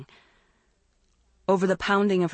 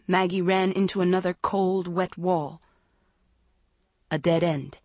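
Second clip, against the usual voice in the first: usual speaking voice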